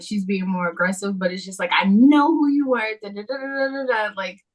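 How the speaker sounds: ordinary talk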